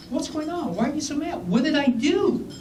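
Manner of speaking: high, bewildered questions; baffled surprise. Retold or imitated. Editor curses him on the phone, he answers high and baffled. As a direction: sound caught off guard and confused.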